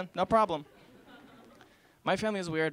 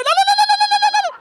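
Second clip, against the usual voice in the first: very high, loud panicked denials; frantic no; 14 dB louder, faster than usual. Acted. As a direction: take it back in a squealing panic. Frantic retraction after joke.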